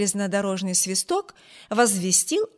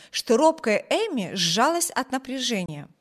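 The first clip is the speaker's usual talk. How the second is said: plain reading voice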